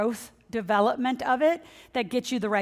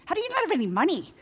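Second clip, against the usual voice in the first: higher and fast, incredulous rhetorical question; mock disbelief; 8 semitones higher, faster than usual. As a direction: toss it out in playful disbelief.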